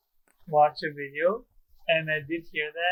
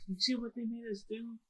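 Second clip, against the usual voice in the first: higher but much softer blame-shifting; corrected Odin line, softer; 6 semitones higher, 13 dB quieter than usual. Retold or imitated. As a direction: say it again softly, correcting the words. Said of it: "Like, no, like".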